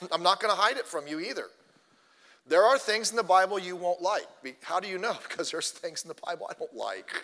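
ordinary delivery

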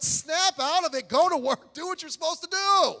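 loud, high preacher's rebuke; scolding shout. Real, not acted. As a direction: shout the orders, hammering each one.

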